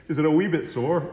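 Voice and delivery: a light, laughing attempt at a Scottish accent; jokey Scottish accent. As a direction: put on a mild Scottish accent for the client, chuckling. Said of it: "that's about as good as my Scottish accent".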